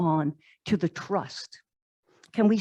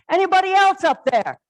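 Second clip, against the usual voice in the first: higher, louder comic shout upward; comic call out; 9 semitones higher, 6 dB louder than usual. Acted. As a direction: holler up for anyone else. Climber calls for someone else.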